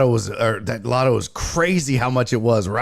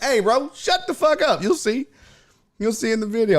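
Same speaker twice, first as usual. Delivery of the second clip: retold heckler comeback; 9 semitones higher than usual. Retelling his comeback to the heckler.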